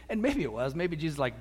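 ordinary delivery

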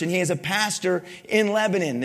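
ordinary talk, nothing marked

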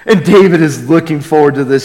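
ordinary delivery

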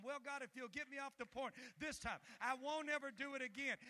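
high, loud pleading to God; desperate bargaining prayer. Retold or imitated. Pastor voicing a sinner's bargain with God, loud pleading. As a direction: beg God like a cornered sinner.

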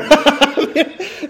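big high laugh breaking out; real laugh at the memory; loud laugh of delight; bursting laugh. Real, not acted. Laughs at own story.

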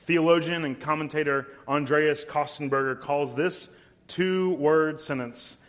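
ordinary delivery

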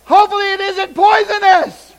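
loud, high-pitched alarmed shouting; freaked out. Retold or imitated. Royal Ranger commanders reacting to the boy's catch, freaked out. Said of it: "commanders freaked out because they're like".

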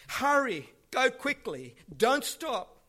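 high, loud urgent call; yelling. Retold or imitated. Jonathan yells after the little boy, loud urgent call. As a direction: yell it after a running child. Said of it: "yells to the little boy".

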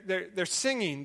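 normal talking voice

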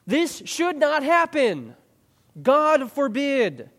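high, slow, drawn-out insistence; emphatic professor. Retold or imitated. Professor stressing it in class, slow insistent warning. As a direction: stretch each word out with weight. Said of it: "He says".

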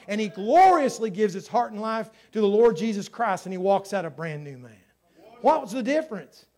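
loud, driving sermon delivery; emphatic pulpit storytelling at full voice; impassioned preaching. Real, not acted. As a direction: preach it with rising force to a big climax.

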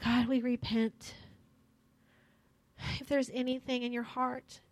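intimate worship prayer. Acted. Leads prayer over music.

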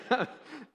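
sudden high laugh at his own joke; real laugh; burst of laughter. Real, not acted. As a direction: break into a laugh.

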